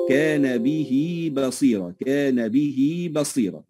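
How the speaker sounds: slow recitation, به lengthened to bihii; ha joined with ya. Shown as a demonstration. Teacher demonstrates the kasra rule, به drawn out as bihii. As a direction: stretch به into a long ee before بصيرة. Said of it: "فنصلها بياء".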